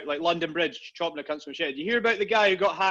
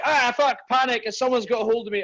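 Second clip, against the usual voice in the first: mock panic; 6 semitones higher, 3 dB louder than usual. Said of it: "most people would be like".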